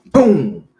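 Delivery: big drawn-out "boom" for a kick; loud imitated impact. Storyteller kicking the neighbor's car, loud impact noise. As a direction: boom it out suddenly.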